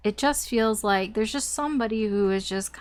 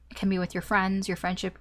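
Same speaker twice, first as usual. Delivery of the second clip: normal talking voice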